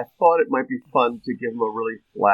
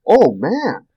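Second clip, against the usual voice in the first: excited; 7 semitones higher, 5 dB louder, slower than usual. Retold or imitated. Excited about his new one.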